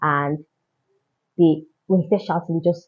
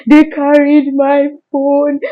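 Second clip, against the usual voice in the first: slow, high wail of distress; 7 semitones higher, 5 dB louder, slower than usual. Retold or imitated. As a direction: drag out the lament as if robbed. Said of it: "I just started screaming".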